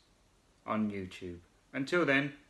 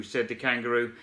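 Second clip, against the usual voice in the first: plain reading voice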